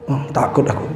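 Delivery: low breathy fearful whisper; scared whisper. Acted. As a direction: whisper it in fear. Frightened drunk friend. (bisikan ketakutan)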